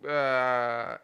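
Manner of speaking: ordinary talk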